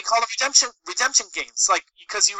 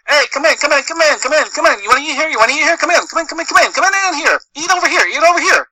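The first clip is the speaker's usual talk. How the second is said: higher, pushy Italian-American New York street hawker; Joe Pesci Italian-American; 7 semitones higher, 4 dB louder, faster than usual. Retold or imitated. Sidewalk cafe guy pulling in diners, Italian-American hustle. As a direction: wave them in like a Little Italy waiter. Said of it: "sounding like Joe Pesci and fucking Goodfellas".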